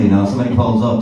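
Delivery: ordinary delivery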